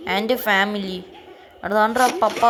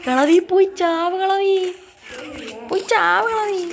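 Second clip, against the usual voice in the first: higher, slower teasing taunt; sing-song taunt; 7 semitones higher than usual. Acted. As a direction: sing the insult out mockingly. Same taunt repeated, higher. (ഉയർന്ന ഈണത്തിൽ കളിയാക്കൽ)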